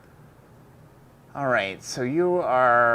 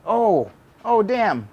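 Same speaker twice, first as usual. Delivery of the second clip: surprised; 8 semitones higher, 6 dB louder, much slower than usual. Real, not acted. Pin slips, sudden exclamation.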